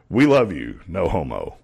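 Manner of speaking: ordinary delivery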